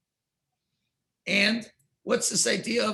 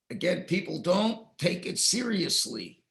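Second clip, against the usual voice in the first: ordinary talk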